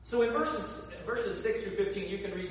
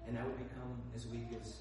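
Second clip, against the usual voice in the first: hushed confession; 5 semitones lower, 9 dB quieter than usual. Acted. Samson finally confesses his secret.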